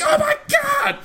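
very high mock shriek; hammed-up hysterical panic. Raptors as the conch call changes, mock hysterical scream. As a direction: shriek like a raptor losing its mind. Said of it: "Just losing their mind".

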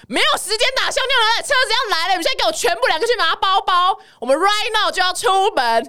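high bossy roar, rushing them out; big yell. Retold or imitated. Host roaring at friends to grab bags, high commanding yell. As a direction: bark orders like a general. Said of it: "我就大吼".

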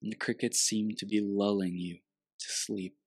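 guided meditation. Acted.

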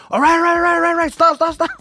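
high, loud mock pleading; panicked surrender. Cornered man to charging boyfriend, mock panic. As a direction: rattle it out like a cornered coward.

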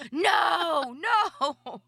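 high, loud, drawn-out "no"; re-enacted urgent shout across a playground; retold alarmed call. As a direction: call out to stop someone, stretching each no. Calling out to stop child snatching.

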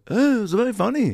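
[name] impression. McCartney to the comic, praising the joke in a high Scouse voice. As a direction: pitch it way up in a Scouse lilt. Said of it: "And he goes".

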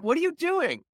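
very high, questioning mom voice; imitated suspicious mother. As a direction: mimic a mother checking up on her child. Suspicious mother questions quiet child.

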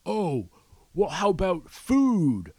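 high-pitched mock retort; mocking objectors. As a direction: parody a defensive pot fan. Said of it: "they'll be like".

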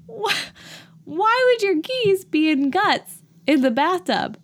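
high, slow, exasperated rant; angry disbelief. Retold or imitated. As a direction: draw out the outraged question. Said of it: "I became angry about it".

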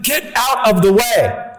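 high, slow mock scolding wife; lightly voiced irritated wife. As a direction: play the annoyed wife shoving him aside. Wife telling husband off.

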